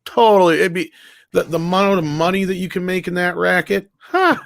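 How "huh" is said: usual speaking voice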